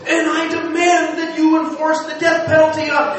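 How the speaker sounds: imitating a reporter losing his cool; worked-up demand. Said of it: "is so twisted".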